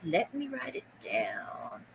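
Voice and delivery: soft slow whisper of Let me write it down; whispered mantra. Shown as a demonstration. Seller whispering her mantra to herself, slow whisper. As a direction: breathe the words to yourself slowly. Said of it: "That's my mantra".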